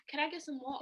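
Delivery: mock British drama delivery; English TV-show accent. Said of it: "English shows to be like".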